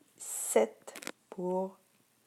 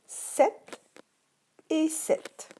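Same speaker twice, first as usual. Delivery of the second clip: "sept et sept" said much higher; raised model words; 10 semitones higher, much slower than usual. Shown as a demonstration. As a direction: present both words in a high, bright teacher voice. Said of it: "Il y a".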